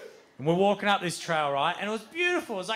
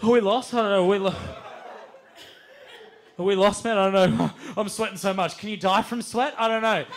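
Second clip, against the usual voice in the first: loud, slightly raised panicked babble; acted panic; 3 semitones higher, 5 dB louder than usual. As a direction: play a lost friend panicking, loud and repetitive. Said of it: "panic sets into the team".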